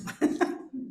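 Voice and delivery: a soft, unvoiced embarrassed laugh; nervous laugh. Real, not acted. Preacher after admitting she is nervous, soft shy laugh. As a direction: breathe out a shy little laugh.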